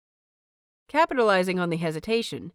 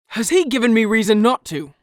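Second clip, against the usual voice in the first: louder, fast and biting; harsh retort; 3 semitones higher, 8 dB louder, faster than usual. A narrator's reading. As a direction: throw the question back like a slap. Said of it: "counters harshly".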